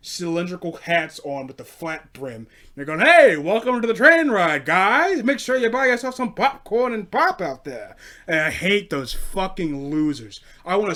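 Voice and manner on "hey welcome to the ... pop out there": loud, higher chirpy host voice; mocking greeter. Comedian mocking costumed train greeters, loud chirpy parody. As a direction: ham up the cheery greeter.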